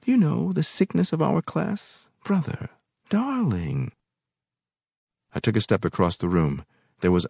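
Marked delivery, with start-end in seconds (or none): 0.0-3.9 mocking woman. A narrator's reading.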